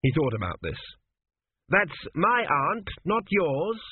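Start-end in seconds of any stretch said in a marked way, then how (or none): 1.7-3.8 put-on higher, slow fussy old king's voice; an old king's ghost pleading, pitched up. A narrator's reading. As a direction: play the dead father, urgent and high.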